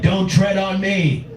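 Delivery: high, drawn-out slogan cry; defiant Republican. Acted. Comic mimicking Republicans' slogan, high defiant cry. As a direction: declare it slow and high, mock-defiant. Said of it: "Republicans are like".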